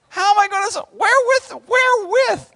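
very high, loud squeal; hammed-up falsetto alarm; panicked questioning. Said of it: "Notice how he says".

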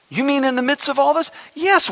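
ordinary delivery

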